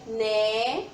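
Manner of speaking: "Né" drawn out as a lone syllable. Shown as a demonstration. Teacher confirms the next syllable for children, stretching "Né" out on its own. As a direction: hold the vowel of "Né" and leave it hanging. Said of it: "qual é a próxima".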